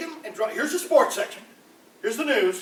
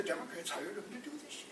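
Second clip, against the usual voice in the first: whispered aside to the crowd; conspiratorial whisper; 13 dB quieter than usual. Acted. Comedian whispering a conspiracy to the audience. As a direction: let them in on a secret, whispered.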